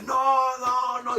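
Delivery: loud, high, drawn-out cry of no; imitated shouting. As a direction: cry out a long high protest. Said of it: "Comenzó a gritar".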